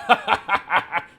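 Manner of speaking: loud scornful barkeep laugh; mocking laugh. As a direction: laugh in the guest's face.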